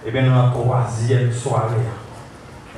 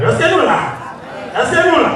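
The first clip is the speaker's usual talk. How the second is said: high-pitched rallying question; calling out; 13 semitones higher than usual. Real, not acted. Preacher checking the congregation is with them, high raised call. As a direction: call for the crowd to answer.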